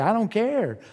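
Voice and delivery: a hammed-up I don't care; mock apathetic brush-off. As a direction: shrug God off, set on your own plan. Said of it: "We're apathetic".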